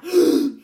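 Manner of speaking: loud sharp gasp; shocked gasp. A narrator's reading. Duke reeling at the sight, loud gasp. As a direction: gasp in astonishment. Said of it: "he gasped".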